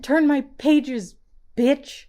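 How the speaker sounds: high, loud, slow shrieking grandma voice; sounds like a grandma. Acted. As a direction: yell it like a cranky grandma. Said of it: "You sound like a grandma".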